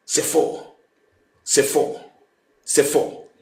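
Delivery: soft, low repeated aside; low denial. Real, not acted. Host repeating that it is false, low denial. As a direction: drop low and quiet, pausing between repeats.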